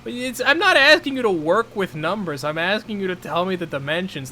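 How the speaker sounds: exasperated. Real, not acted. Exasperated argument about fridge.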